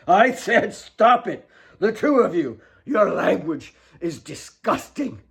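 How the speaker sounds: put-on father shouting the girls into silence; furious shout. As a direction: hammer out each word. Father repeating his order.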